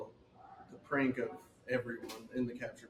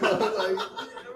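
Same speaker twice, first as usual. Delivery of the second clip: laughing mock quote; 10 semitones higher, 10 dB louder than usual. Laughing quote of man on video.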